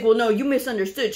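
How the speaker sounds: defensive backpedaling. Retold or imitated. Provider to the offended partner, defensive backpedaling. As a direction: imitate someone wriggling out of it.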